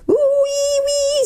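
long, very high squeal. A narrator's reading. Gnome squeals as his mouth is let open, squealing. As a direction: squeal like a caught pig, drawn out. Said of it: "squealed the Earthman".

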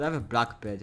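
normal talking voice

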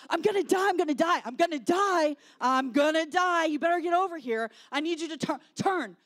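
hammed-up battle panic, shouted; imitating frantic yelling. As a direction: yell for backup as if about to die in a game. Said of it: "They are yelling".

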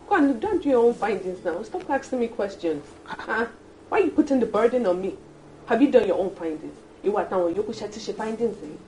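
angry outburst. Acted. Wife snaps at colleague asking about the boss, angrily. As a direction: push back hard on his questions. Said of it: "why you're talking like this".

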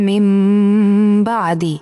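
"মিম" held as a long hummed m before "বা"; ghunnah. Shown as a demonstration. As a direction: hum through the m of "মিম", then release into "বা আদি". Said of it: "এই যে গুণনা করে পড়তে হবে". ("মিম"-এর ম গুন্নাসহ টেনে তারপর "বা আদি")

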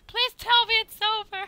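very high squeaky begging; mock desperate plea. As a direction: beg in a tiny falsetto. Mock pleading after the crash.

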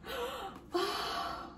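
soft, high gasp; moved gasp. Real, not acted. Reader recognizing old family pictures, gasping softly. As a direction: gasp softly, taken aback.

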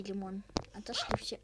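ordinary talk, nothing marked

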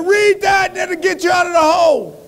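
high, hollered preacher command; Baptist preacher. Acted. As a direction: order it from the pulpit at a shout. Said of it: "He said".

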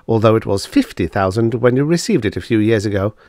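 ordinary delivery

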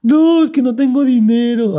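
high, slow pleading fangirl; mock whiny fan. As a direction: whine it like a broke superfan. Imitating broke fan.

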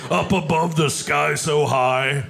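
giggly stoner Seth Rogen. Acted. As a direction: break into wheezy laughs. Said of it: "Seth Rogen".

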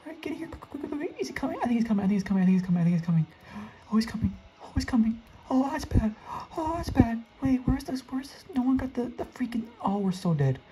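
high, breathless panicked chatter; scrambling in real fear; frantic panic. Real, not acted. Monster chasing, frantic escape.

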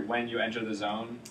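ordinary delivery

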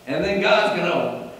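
high, loud mock prosperity preacher. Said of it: "the health and wealth churches are going to say".

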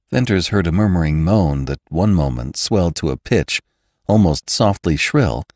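ordinary delivery, nothing marked

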